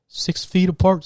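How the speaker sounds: mock staff reminder, voiced up; mock announcement. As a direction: parrot the covid announcement. Covid rule being recited.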